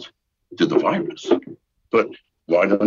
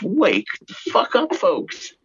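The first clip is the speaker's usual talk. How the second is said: exasperated, pitched way up; fed-up cry to wake people up; rising annoyed shout; annoyed outburst; 12 semitones higher than usual. Real, not acted. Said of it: "I'm getting annoyed".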